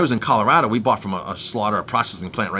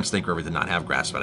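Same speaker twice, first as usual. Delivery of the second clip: ordinary talk, nothing marked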